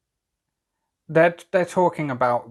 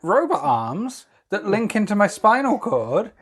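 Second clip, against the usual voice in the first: higher-pitched, hammed-up waving away of praise; mock dismissive; 7 semitones higher, 3 dB louder than usual. Inventor asked about his robot arms, mock dismissive. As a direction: play the inventor shrugging off his own marvel.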